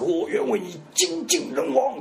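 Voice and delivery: 模仿深情告白，尾音下落; 像吴孟达一样深情表白; 动情告白. Retold or imitated. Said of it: "他那句动情的告白是". (轻轻模仿深情告白)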